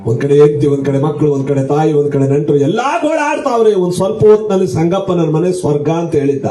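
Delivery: normal talking voice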